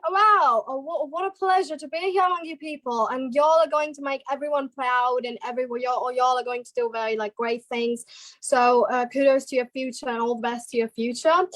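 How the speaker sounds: Australian English praise; Australian-accented speech to an audience. Shown as a demonstration. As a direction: congratulate them in an Australian accent. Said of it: "Australian accent".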